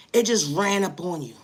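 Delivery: ordinary delivery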